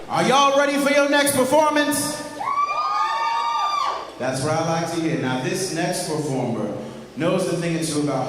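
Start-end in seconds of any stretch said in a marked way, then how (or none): 0.1-2.2 crowd-rousing shout. Acted. Host rallies the crowd.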